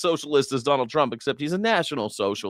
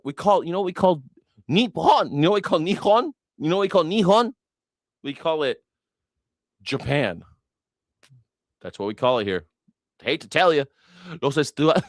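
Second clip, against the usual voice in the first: ordinary talk, nothing marked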